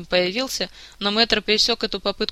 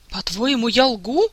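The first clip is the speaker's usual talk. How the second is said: rising, louder indignation; offended outburst; 4 semitones higher, 3 dB louder, slower than usual. A narrator's reading. The prince, insulted by the king, flaring up indignantly. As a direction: flare up at the insult. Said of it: "оскорблённо вскинулся принц".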